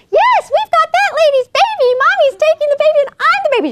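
very high, put-on child voice; tattling little kid. Little kid telling on mommy to the receipt checker, squealing.

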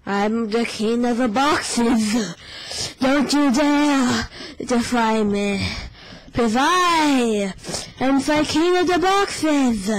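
slow, loud, booming tyrant; dark lord. Pretend. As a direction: gloat like a dark lord on his throne. Taunting as box king, slow.

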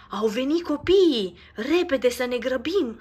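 loud, high urgent call; urgent shout. Acted. Nico hears the children coming and shouts to hurry. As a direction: hurry everyone along. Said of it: "strigă Nico".

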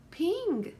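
high call of the name, lightly voiced boy; clever friend calling. A narrator's reading. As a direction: call out to Ping from a different voice. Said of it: "he said".

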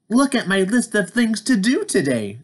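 bright little Toad showing off his list. Acted. Toad's voice in read-aloud.

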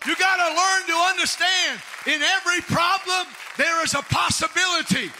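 loud, high shouted preaching; full-force preaching. Acted. Pastor shouting to the congregation, loud preaching.

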